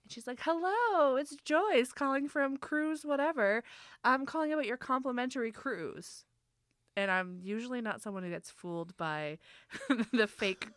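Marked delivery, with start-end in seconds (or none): 0.4-6.2 Joyce. Retold or imitated. Telemarketer calling about a free cruise, friendly. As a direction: imitate a chirpy sales caller. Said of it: "is not friendly Joyce".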